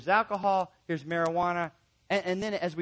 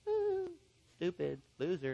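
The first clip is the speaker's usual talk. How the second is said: mock taunt; 5 dB quieter than usual. Mocking how the client heard it.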